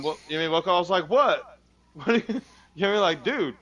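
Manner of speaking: usual speaking voice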